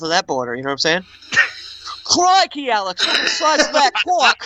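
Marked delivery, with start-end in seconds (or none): 2.0-4.5 hammed-up Australian accent, high; a jokey Australian gal shouting crikey. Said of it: "maybe an Australian chick".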